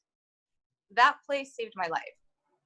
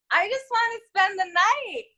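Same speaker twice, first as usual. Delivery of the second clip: high, pleading little voice; childlike whine; 10 semitones higher than usual. Retold or imitated. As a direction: beg like a small child. Whiny reply to psychiatrist.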